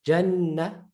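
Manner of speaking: Jannah joined up, long held N; joined double N. Shown as a demonstration. Teacher joining shadda to previous letter, doubled N. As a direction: run straight into a long N in Jannah. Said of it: "read it with the, with the letter before".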